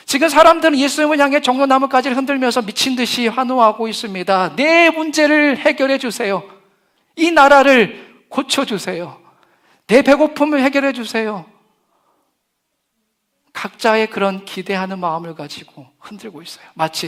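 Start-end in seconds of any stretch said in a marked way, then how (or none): 4.6-11.5 loud, high pleading cries; crowd's pleas. Pretend. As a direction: cry out begging for help.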